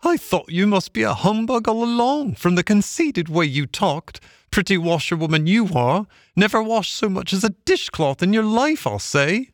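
very high, swooping scorn; mocking barge woman. A narrator's reading. Barge woman exposing Toad as a humbug, high and mocking. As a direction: jeer in a high-pitched woman's voice.

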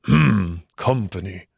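grunted, dropping away at the end; grumpy grunt. A narrator's reading. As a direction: grunt it out dismissively. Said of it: "then grunted".

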